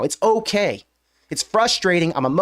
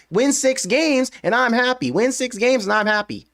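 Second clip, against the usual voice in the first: mocking imitation; 6 semitones higher than usual. Mimicking fans' preseason talk.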